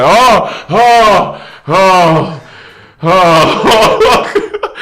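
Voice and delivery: high, loud drawn-out groans of relief; relieved groans. Retold or imitated. Groaning on the toilet, loud relief. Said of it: "I was like".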